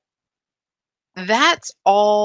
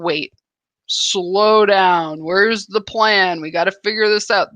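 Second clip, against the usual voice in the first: louder, pressing voice of a worried mind; anxious brain; 6 dB louder than usual. Acted. Host voicing the panicked brain wanting order, mock alarm. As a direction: play the part of your brain demanding a plan.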